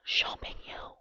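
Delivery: Champignon whispered softly in French. Shown as a demonstration. Whispering the mushroom name to the camera, in French. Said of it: "I wanna say it in French".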